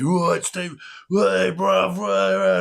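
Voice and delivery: high, loud mock drunk greeting; drunk travellers greeting. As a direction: ham up the rowdy drunk lads. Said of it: "travellers come up to me".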